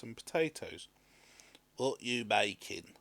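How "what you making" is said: slow husband asking; a slow curious man; husband's voice. A narrator's reading. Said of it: "asked the woman's husband".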